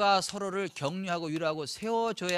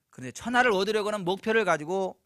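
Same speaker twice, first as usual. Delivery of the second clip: normal talking voice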